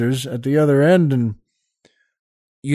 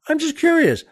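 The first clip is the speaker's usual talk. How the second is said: curious director; 13 semitones higher than usual. Acted. Director questions the third patient.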